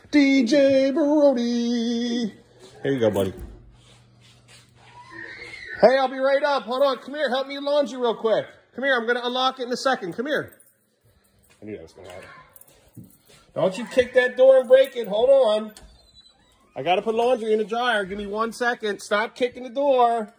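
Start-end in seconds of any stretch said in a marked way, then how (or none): 0.1-2.3 high, very slow, drawn-out intro; DJ announcer call. Pretend. Parent introduces child as DJ, playful announcer call. 5.8-10.6 calling out. Real, not acted. Parent calls to child behind locked door, calling out. 13.5-20.3 raised, higher voice, insistent; calling out a warning. Real, not acted. Parent warns child kicking the door, calling out.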